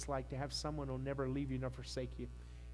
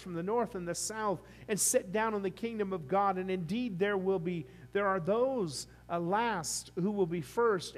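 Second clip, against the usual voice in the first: raised, ringing pulpit reading; scripture reading; 5 semitones higher, 5 dB louder than usual. Acted. Pastor reading scripture to congregation, raised pulpit voice. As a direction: read the Gospel out to the whole church.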